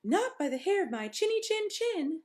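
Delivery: little pig refusing the wolf. A narrator's reading. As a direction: squeak it back defiantly.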